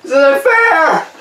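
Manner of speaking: very high, slow, loud wail; panicked shout. Acted. Balloon blower protests before the bang, shrieking in panic. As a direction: stretch it into a desperate shrieked protest.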